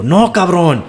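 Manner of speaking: hammed-up outraged son, pitched high; indignant son. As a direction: squeal out the protest like a riled-up son.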